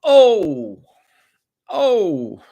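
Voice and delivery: loud, very high falling "ohh"; coworker's high "oh". Retold or imitated. Imitating Jerry, a guy at work, high loud hoot. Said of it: "He always goes".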